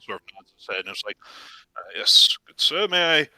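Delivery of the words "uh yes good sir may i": lightly voiced courteous priest; a hint of a formal churchman. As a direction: address them politely as an official cleric. Priest addresses the customer formally.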